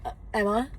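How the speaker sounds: mild retold stumble, "Emma" lifted like a question; hesitant. Girl giving fans a fake name, hesitant. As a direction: give the false name with a small hesitation. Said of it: "You stuttered. Your name is not Emma".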